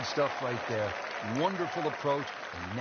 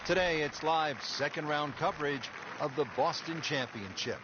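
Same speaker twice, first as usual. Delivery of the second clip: announcer voice; 4 semitones higher than usual. Acted. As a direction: open the show like a TV announcer. Game intro announcer read.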